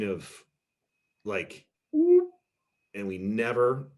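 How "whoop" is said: a sudden loud, high whoop; a quick vocal swoosh as it all drops; whoop sound. Retold or imitated. Family argument ending abruptly, loud high whoop.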